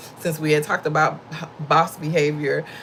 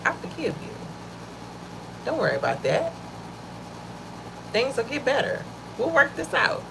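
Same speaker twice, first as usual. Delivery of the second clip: gentle forgiving words; 8 semitones higher, 5 dB quieter than usual. Shown as a demonstration. Modelling compassionate words to offer.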